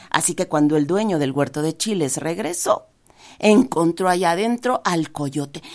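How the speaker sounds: usual speaking voice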